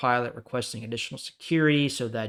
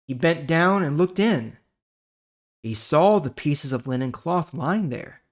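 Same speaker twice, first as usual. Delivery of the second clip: ordinary talk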